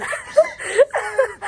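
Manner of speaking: high, loud shrieking cackle; shrieking laugh. Real, not acted. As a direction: let out a screaming laugh. Cackling at the story.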